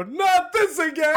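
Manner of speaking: a high mock whine of not again; mock dread. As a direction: squeal it like a weary reenactment. Mock dread at a repeat date.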